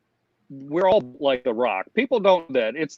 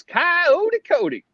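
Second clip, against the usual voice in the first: higher, slow Southern drawl; played-up Southern accent; 4 dB louder, much slower than usual. Shown as a demonstration. Skipper introducing himself to guests, played-up Southern accent. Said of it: "I would play up my Southern accent".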